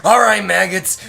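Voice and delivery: gunnery sergeant. Acted. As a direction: order recruits around like a mock sergeant. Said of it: "like if you were a gunnery sergeant".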